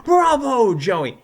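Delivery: loud, high bravo; jubilant praise. Acted. Teacher congratulating his pupil, jubilant. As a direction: cheer him loudly.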